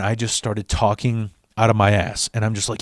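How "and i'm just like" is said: ordinary talk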